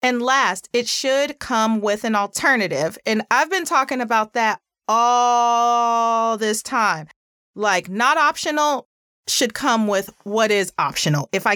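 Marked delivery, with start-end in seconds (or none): none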